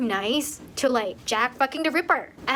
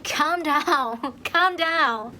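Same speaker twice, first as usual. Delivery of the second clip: mock exasperation, higher pitch; exasperated; 5 semitones higher, 3 dB louder, much slower than usual. Reader answering a dramatic heroine, exasperated. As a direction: tell them off with amused impatience, pitched up.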